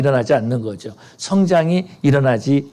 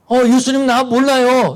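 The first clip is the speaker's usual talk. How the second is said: mock denial; 8 semitones higher than usual. Mock believer denying Jesus.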